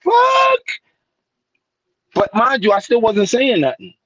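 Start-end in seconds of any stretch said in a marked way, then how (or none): 0.0-0.6 imitating stunned listeners in a high, stretched cry; astonished shout. Brooklyn dudes reacting to his chant, high slow astonished shout.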